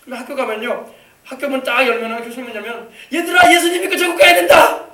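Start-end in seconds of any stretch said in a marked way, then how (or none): 3.1-4.9 high, loud evangelizing shout; acted calling out. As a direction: call out to a whole classroom of children. Mother evangelizes loudly to a classroom. (교실에 대고 높고 크게 외치는 전도)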